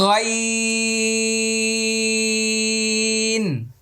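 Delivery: Arabic: غين ممدود; Arabic letter name. Shown as a demonstration. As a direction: stretch গইন slowly from the back of the throat. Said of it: "ওকে, আবার লক্ষ্য করুন".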